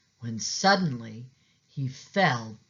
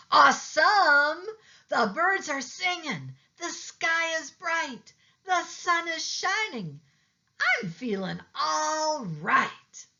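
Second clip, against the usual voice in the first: delighted squirrel; 14 semitones higher than usual. A narrator's reading. Squirrel thrilled by the sunglasses, high and gleeful. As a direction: gush like the world just lit up.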